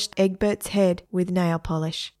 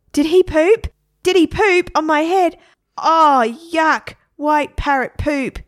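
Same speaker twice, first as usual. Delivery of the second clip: put-on high, loud, appalled boy; disgusted outrage; 9 semitones higher, 7 dB louder than usual. Boy with parrot poop on his head, high disgust. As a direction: exclaim in disgust, pitch raised.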